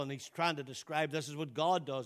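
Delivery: usual speaking voice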